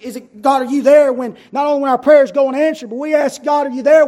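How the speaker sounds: crying out loud to God; loud plea. Acted.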